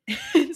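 loud, high laugh; short burst of laughter. Real, not acted. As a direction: laugh out at the joke. Laughing at her own joke.

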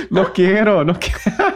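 laughing. Real, not acted. Laughing refusal.

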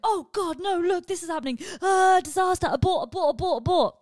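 loud, high alarmed cry; mock panic. Acted panic about turning forty.

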